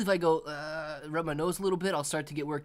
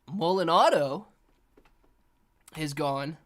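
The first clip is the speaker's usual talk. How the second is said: ordinary delivery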